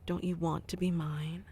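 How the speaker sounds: ordinary talk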